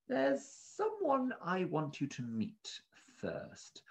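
high, soft sly villain; slow, quiet scheming voice; Ebenezer voice. Acted.

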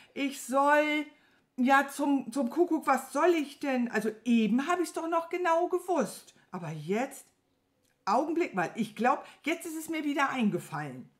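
straining to remember. A narrator's reading. Servant playing dumb before the wizard, straining to remember. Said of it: "als müsste er angestrengt nachdenken".

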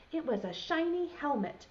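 plain reading voice

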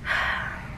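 long, soft exasperated exhale; weary sigh. Acted. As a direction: sigh out your annoyance. Fed up after mimicking coworker.

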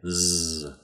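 "Z" as a sustained buzzing zzz; Z sound. Shown as a demonstration. Teacher contrasting it with S, "Z" as a buzzed sound. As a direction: say "Z" as just its voiced buzz, held. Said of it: "and not as a Z".

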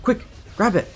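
high, urgent pleading for the last key; keyed-up, almost-there urgency; frantic excitement. Real, not acted.

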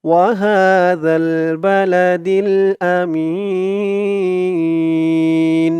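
slow melodic chant; Quran recitation. Acted. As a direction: draw out each word in tilawat. Recites the verse. (সুরেলা ধীর তিলাওয়াত)

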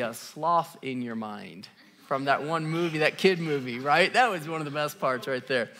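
ordinary talk, nothing marked

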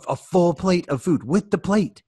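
usual speaking voice

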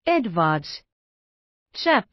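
startled, the name cut short in alarm; stammering in fright. A narrator's reading. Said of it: "Kesselbach sopersi". (kauhuissaan sopertaen, katkeava huuto)

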